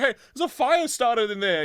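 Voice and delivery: high, loud acted warning; alarmed shout. Passer-by warns guard again.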